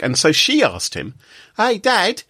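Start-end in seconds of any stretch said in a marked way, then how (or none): 1.6-2.2 high-pitched attempt at a Boston teenager; unconvincing high Boston-accent impression; mock Boston accent. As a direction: imitate a Boston daughter asking dad, badly, pitched way up. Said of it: "she said it in a Boston accent".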